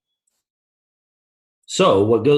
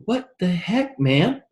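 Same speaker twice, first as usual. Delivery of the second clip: bewildered protest; 10 semitones higher, slower than usual. Acted. Kid on floor protests.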